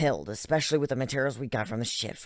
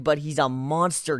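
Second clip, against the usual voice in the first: usual speaking voice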